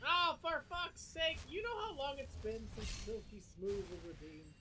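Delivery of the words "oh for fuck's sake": loud, slow, fed-up curse; exasperated outburst. Real, not acted. Streamer cursing at chat's redemption, loud and exasperated. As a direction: drag out the annoyance at chat.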